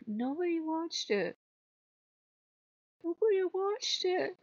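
high, small-voiced complaint; mock dismay. Creator finding no views all day, mock dismay.

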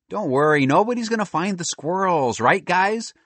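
put-on high, carefree kid voice; lightly acted confident boy; breezy reassurance. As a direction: brush off the worry, pitched high. Boy reassures friend, calls to squirrels.

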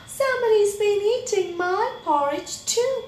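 high, loud, rising dismay; shocked Mommy Bear. A narrator's reading. Mommy Bear discovering her eaten porridge, high-pitched shock. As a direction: pitch it way up, aghast.

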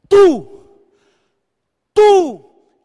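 un tú gritado, mucho más fuerte; señala al público con un grito. Real, not acted. (al público de México, gritando: tú)

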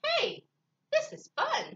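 very high, excited bird; delighted discovery. Acted. Bird enjoying the animals copying him, excited and high. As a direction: burst out with sudden excitement.

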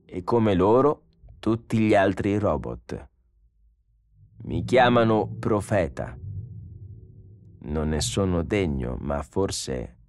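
slow, calm robot preacher; measured, deliberate machine voice; solemn robot prophet. Acted. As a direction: explain it with serene, pious certainty.

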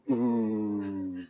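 long quiet ooh; soft drawn-out ooh. Shown as a demonstration. Teacher joking about a frog, soft long ooh. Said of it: "everybody go, ooh".

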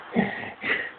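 breathy quiet laugh; quiet real chuckle; laughing under the breath; soft laugh. Real, not acted. Laughing at drunk dog.

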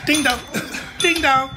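ordinary talk

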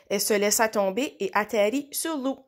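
ordinary delivery

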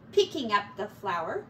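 ordinary delivery, nothing marked

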